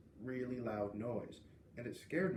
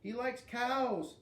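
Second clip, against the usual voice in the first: higher, slow taunting call; yelling after; 10 semitones higher, much slower than usual. A narrator's reading. Mean girl yelling taunts down the hall. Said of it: "Lexi yelled after me".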